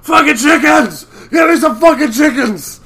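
high, loud growling demand; slow, loud mock demand; quoting the Hound. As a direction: yell for chickens like the Hound. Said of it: "he says my favorite line ever".

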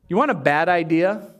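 ordinary talk

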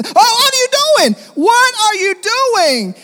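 high, loud, slow panicked scream; terrified shriek. Acted. Woman to praying pastor, mock terrified high shriek. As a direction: beg for your life in mock terror. Woman fears being struck dead.

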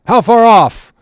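high, loud call upward; shouted question. Acted. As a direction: fling the question up the mast. Captain shouts question aloft.

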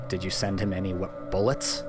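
ordinary talk, nothing marked

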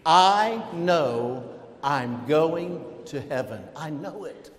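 I KNOW I'm going to heaven, drawn out for all to join; measured chant-along, strong on "I know", easing off on "heaven"; leading a recitation. Shown as a demonstration. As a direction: lead the congregation word by word, slowly. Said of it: "Say that with me".

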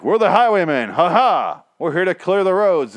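high, loud heroic proclamation; mock-heroic fanfare voice; pompous would-be heroes. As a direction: announce yourselves like hammy saviors. Mocking pompous heroes arriving.

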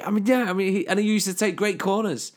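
high and laughing through a tease; giggling while winding up his mate. Real, not acted. Needling his co-host with laughter. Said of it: "Oh, he's fuming".

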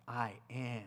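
soft, quiet and lowly; quiet voicing of Jesus, gentle. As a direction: hush it down, tenderly. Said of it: "the state of his heart".

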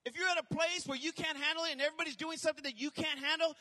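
rising, loud sermon build; building from firm to shouting; fiery preaching. Acted. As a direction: preach it to the crowd with fire. Preaching at force.

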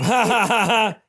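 high burst of laughter; laughing. Real, not acted. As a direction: laugh it out loud. Laughing after joke.

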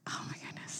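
hushed whisper of suspense. Real, not acted. Mom whispering to listeners at the tense moment, hushed. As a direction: breathe it like a secret.

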